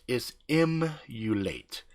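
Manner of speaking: slow, careful "emulate" with a falling close; slow, soft corrective "emulate"; correct pronunciation. Shown as a demonstration. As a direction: spell out "emulate" gently, slowly. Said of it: "it's not emulate".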